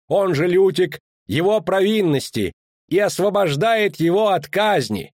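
high, sustained herald's reading; official proclamation. Acted. Official reading the duchess's pardon to townspeople, proclaiming. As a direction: declaim it formally to the public.

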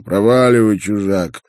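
loud, rough dismissal; rude. A narrator's reading. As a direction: tell the stranger off rudely. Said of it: "грубо сказал".

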